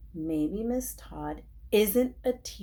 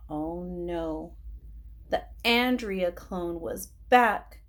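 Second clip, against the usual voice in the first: ordinary delivery, nothing marked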